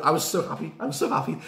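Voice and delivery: usual speaking voice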